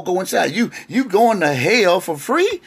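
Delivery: ordinary delivery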